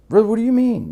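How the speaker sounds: high, loud bewildered question; baffled. Retold or imitated. Buyers thrown by his evasive answer, loudly baffled. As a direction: imitate someone completely thrown.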